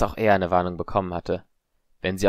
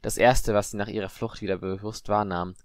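usual speaking voice

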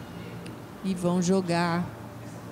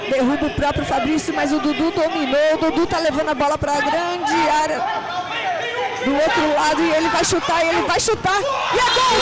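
rising to an all-out goal shout; explosive goal commentary; 6 semitones higher, 4 dB louder than usual. Real, not acted. Goal build-up in futsal commentary.

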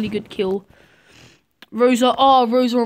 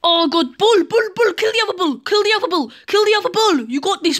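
much higher and louder, urgent commands; frantic shouting; 9 semitones higher, 6 dB louder than usual. Real, not acted. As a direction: yell at David to kill the other bull. Panicked calling out in fight.

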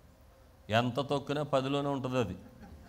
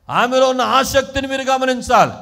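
loud, high, insistent preaching; preaching at full force; 8 semitones higher, 13 dB louder than usual. Real, not acted. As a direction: urge the congregation on forcefully.

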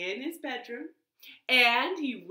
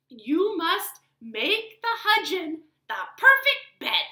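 put-on higher old woman giving orders; a lightly voiced wise woman instructing; 10 semitones higher, 8 dB louder than usual. Wise woman tells the tailor what to do, higher. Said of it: "said the wise woman".

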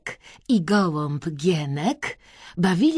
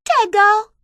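high, loud squeaky question; questioning princesses; 9 dB louder than usual. A narrator's reading. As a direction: ask sharply in a high princess voice. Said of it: "spytały obie królewny".

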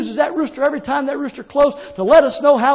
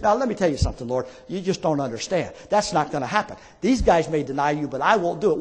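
low, softer aside as Peter; confident Peter; 8 semitones lower, 4 dB quieter than usual. Acted. Peter assuring the Lord he won't deny him, low and confident. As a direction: drop down low and dismiss the warning.

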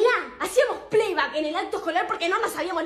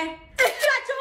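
gleeful shout; 9 semitones higher, much slower than usual. Acted. Gleeful exposing of a friend.

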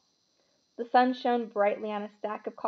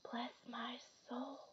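slow, hushed whisper of awe; 2 semitones higher, 20 dB quieter, slower than usual. A narrator's reading. Old bartender recognizing Harry Potter, whispered. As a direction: whisper it slowly, almost no voice. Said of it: "whispered the old bartender".

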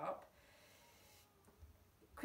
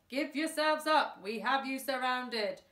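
higher, much louder police announcement; loudspeaker voice; 3 semitones higher, 6 dB louder than usual. A narrator's reading. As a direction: call it out through a megaphone. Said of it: "A voice came over a loudspeaker".

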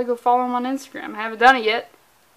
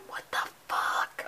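stunned unvoiced whisper; awed whisper; 12 dB quieter, slower than usual. Staring at the cover art, an awed whisper. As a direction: breathe it out, dumbstruck by beauty.

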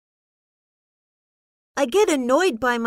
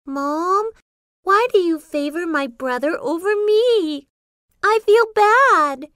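hurt protest, rising louder and higher; upset complaint; 4 semitones higher, 3 dB louder than usual. Acted. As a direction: complain to Mom, raised pitch on the last words. Child upset at mom's favoritism.

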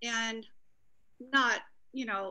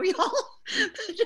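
laughing through the words, louder; embarrassed laugh at sharing too much; 8 semitones higher, 7 dB louder than usual. Real, not acted. Laughs at own openness.

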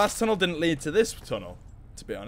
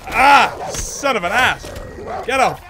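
loud, high startled cries; startled yelling; 6 semitones higher, 8 dB louder, slower than usual. Real, not acted. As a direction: yell as something bites you. Dog attacks him.

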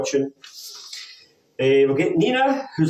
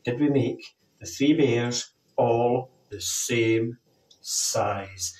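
put-on kids party magician; 3 semitones lower, 3 dB quieter, much slower than usual. Magic for the children.

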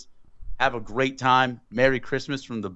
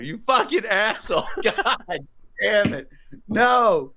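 laughing outrage; 10 semitones higher, slower than usual. Real, not acted. Outraged at friend's review joke.

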